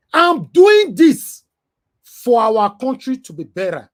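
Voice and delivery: high, loud and slow, pleading for the country; impassioned shout. Real, not acted. Scene: vlogger defending his activism to critics, impassioned shout. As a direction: lift it up and drag out I'm doing this. Passionate rant peak.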